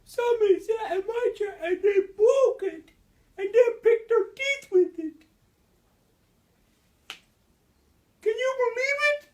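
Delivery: very high, loud squeaky wail; Baby Bear. A narrator's reading. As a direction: squeal it like an upset little bear cub. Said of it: "And Baby Bear says".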